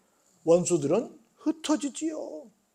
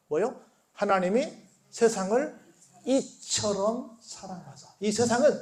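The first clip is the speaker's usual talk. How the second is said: normal talking voice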